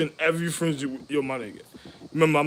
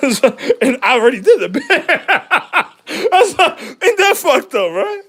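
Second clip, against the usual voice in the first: higher, louder words through laughter; laughing; 9 semitones higher, 6 dB louder than usual. Real, not acted. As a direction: laugh it out in disbelief. Laughing at own misfortune.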